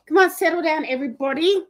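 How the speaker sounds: high, loud call to the dog; firm high command to settle Lulu. Real, not acted. To her excited dog Lulu, a high loud call.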